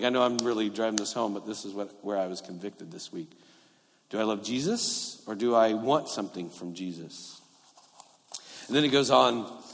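normal talking voice

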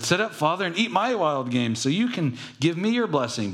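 chirpy, keen to be blessed; Esau pleading. Acted. Esau voiced to his father.

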